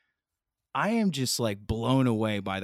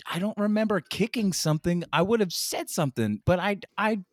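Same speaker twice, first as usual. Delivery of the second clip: higher-pitched puzzled defence; protesting innocence; 7 semitones higher than usual. Retold or imitated. Host defends himself over a broken car, puzzled protest. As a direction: plead a blank memory.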